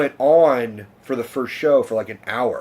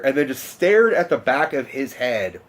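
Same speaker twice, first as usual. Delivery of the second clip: normal talking voice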